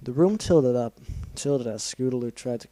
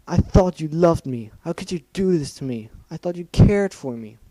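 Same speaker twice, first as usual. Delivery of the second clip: tearful pleading; 6 semitones higher, 7 dB louder than usual. Acted. Scootaloo accusing Rainbow Dash, crying.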